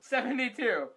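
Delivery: high and loud SEVENTY-TWO; heated insistence. Acted. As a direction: push "Seventy-two" out high, in mock outrage. Heated insistence on absurd number.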